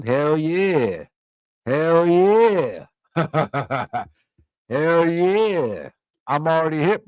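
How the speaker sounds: usual speaking voice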